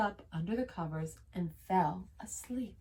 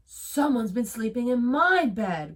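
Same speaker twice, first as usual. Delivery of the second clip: growl; 3 semitones higher, 6 dB louder than usual. A narrator's reading. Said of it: "growled Papa Bear".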